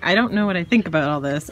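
a hint of a puzzled dog's thoughts; voicing the dog. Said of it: "Chevy's like".

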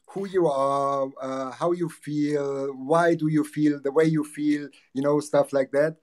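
ordinary talk

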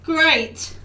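ordinary talk, nothing marked

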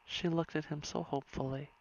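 plain reading voice

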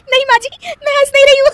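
fast, high, giggling through the words; laughing denial. Acted. Bride denying to mother-in-law that she laughs, giggling. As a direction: insist you aren't laughing while you are.

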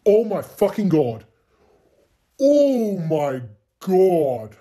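loud, high cry of disbelief; astonished outcry. Real, not acted. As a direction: shout it in amazement, then stretch it out slowly. Astonished at the game result.

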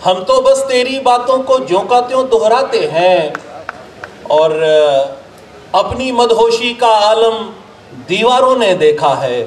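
measured, declaimed Urdu couplets with pauses; ghazal recitation. Acted. Poet presenting a ghazal to an appreciative gathering, declaimed recitation. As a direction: recite verse to a mushaira audience, line by line.

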